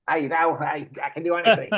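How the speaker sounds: put-on a faint stab at an Australian accent on "I can do anything"; a loose, unconvincing Australian accent, admitting it's off; half-hearted Australian accent. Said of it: "I can't do an Australian accent".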